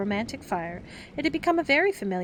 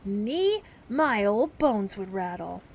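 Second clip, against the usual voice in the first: mock scandalized; 4 dB louder, slower than usual. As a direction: pretend to be shocked. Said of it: "he pretended to be scandalized".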